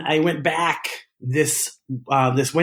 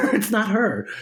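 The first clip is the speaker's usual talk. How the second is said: It's not her repeated higher through a laugh; laughing insistence; 8 semitones higher than usual. Real, not acted.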